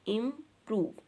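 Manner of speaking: IMPROVE in two separate beats, im, prove; split into syllables. Shown as a demonstration. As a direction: pause briefly inside "improve", after im. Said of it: "टू syllable word".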